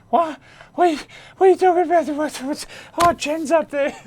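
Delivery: very high, loud and rushed flustered shriek; panicked squeal. Retold or imitated. Bedsy panicking that Jen will smell him, shrieking very high and fast.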